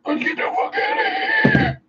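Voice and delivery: extremely high squealed call; shrieky falsetto cry to flee; comic falsetto shout. Pretend. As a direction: squeal it in a mock panic. Repeated as a comic scream.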